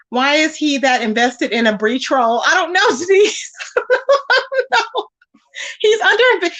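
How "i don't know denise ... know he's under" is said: giggling I don't know; laughing. Real, not acted. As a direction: laugh through the shrug. Laughing reply to Denise.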